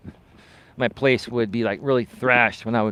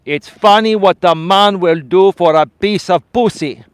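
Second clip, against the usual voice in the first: loud, slow Greek dad impression; thick Greek accent; 6 semitones higher, 9 dB louder than usual. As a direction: imitate a Greek father, high and drawn out. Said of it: "with his thick Greek accent".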